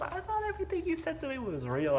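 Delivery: very high, dramatized heartbroken voice; mock-distraught high complaint; retold hurt reproach. Retold breakup, her hurt reproach.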